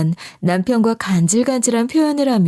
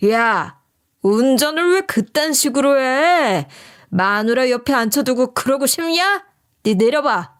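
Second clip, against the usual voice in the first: mock yell of an aggressive driver; acted road-rage scolding; brazen angry yelling; 4 semitones higher than usual. A narrator's reading. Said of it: "이런 식으로 소리를 지르니까".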